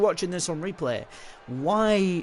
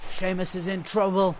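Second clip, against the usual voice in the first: wrestling commentator; 3 dB louder than usual. Pretend. Player mimics game commentary.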